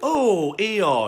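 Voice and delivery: sorry for his friend. A narrator's reading. Christopher Robin consoling Eeyore, with a touch of sorrow. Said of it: "feeling very sorry already".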